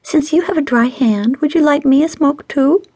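high, wheedling pout. A narrator's reading. Stacy asking John to light her a smoke, pouting. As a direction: coax him with a pouty rising voice. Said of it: "Stacy pouted".

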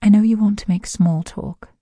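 ordinary delivery

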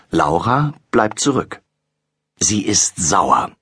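ordinary delivery

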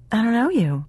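high, mildly snappish retort; snapped. A narrator's reading. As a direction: snap back defensively. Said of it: "she snapped".

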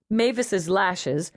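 ordinary delivery

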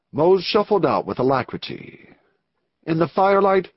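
plain reading voice

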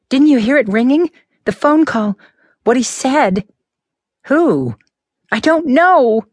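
put-on a frightened teenager pleading with Connor. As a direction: tell it rattled and scared, voice lifted. Frightened Martha recounting the call.

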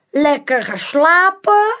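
high, slow, theatrical magician voice; mysterious magician. A narrator's reading. Magician Manolo invites the crowd, slow and theatrical. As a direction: lure the crowd in like a showman.

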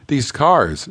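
ordinary delivery

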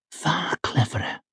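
whispered softly against his jaw; soft urgent whisper. A narrator's reading. Wife flattering her husband cheek to jaw, soft whisper. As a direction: breathe it close, low and urgent. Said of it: "soft, low, urgent voice".

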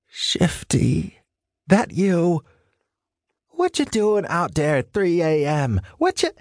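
lightly voiced old woman, demanding; scratchy woman's voice. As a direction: demand to know who's there, scratchy. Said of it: "a woman's scratchy voice demanded".

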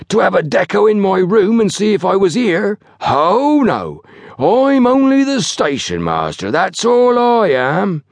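high, heavily sarcastic pomposity, slowing at the end. A narrator's reading. Station master feels ignored by his porters, heavily sarcastic. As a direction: lay on wounded importance with a trowel. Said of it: "the weight of his sarcasm".

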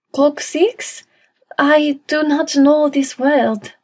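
high, puzzled French maid voice; slow, careful English in a French accent. A narrator's reading. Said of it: "That French accent was so adorable".

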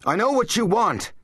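high, panicked accusation; loud cry. A narrator's reading. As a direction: cry out over a buzzing in your head. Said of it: "he cried loudly".

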